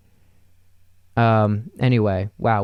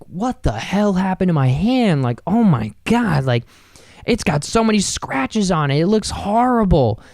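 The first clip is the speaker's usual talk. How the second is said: higher, dismayed disbelief; mock morning-after shock; 6 semitones higher than usual. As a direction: act horrified at the scratched hand. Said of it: "I was like".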